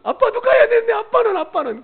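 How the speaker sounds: the words catch in a sob; choked-up crying. Retold or imitated. Father breaking down before his child, voice breaking.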